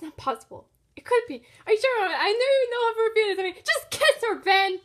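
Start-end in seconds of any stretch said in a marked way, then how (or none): none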